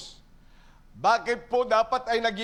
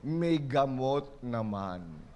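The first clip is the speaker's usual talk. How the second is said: lazy excuse; 9 semitones lower, 6 dB quieter, much slower than usual. Retold or imitated. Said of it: "Ano sasabihin mo?".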